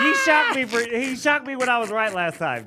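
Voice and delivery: loud protest, high and heated; indignant outburst. Real, not acted. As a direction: call out the unfairness. Angry complaint about being shocked.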